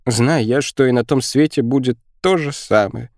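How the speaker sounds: high, plaintive lament; tearful voice. A narrator's reading. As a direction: complain as if close to tears. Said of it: "плачущим голосом сказала женщина".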